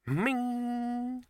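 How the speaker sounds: high, held "ming" of struck china; ringing pot. Acted. Bursar taps the pot, ringing chime. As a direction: let it ring out like a tapped vase.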